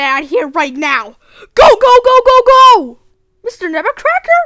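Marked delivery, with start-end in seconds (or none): 1.5-3.0 loud, high rallying yell; urgent shout. Acted. Urging friends to get out of the house, urgent shouting. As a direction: yell them into a run.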